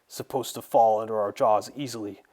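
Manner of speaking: loud, outraged villain's protest; angry cry. A narrator's reading. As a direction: cry out accusingly, building in force. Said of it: "Kualachi cried".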